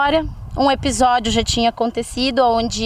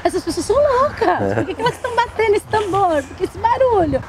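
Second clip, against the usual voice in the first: higher, mock-alarmed questions; retold alarm; 7 semitones higher than usual. Newcomer at the drumming ceremony, mock-alarmed and higher. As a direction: play your past self shocked by the drums.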